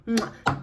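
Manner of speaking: playful "mwah" kiss noise; air-kiss sound. Shown as a demonstration. Vlogger praising her holy grail setting spray, air-kiss mwah. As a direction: make a quick, smacking air kiss.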